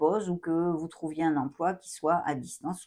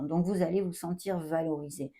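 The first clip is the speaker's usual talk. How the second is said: ordinary talk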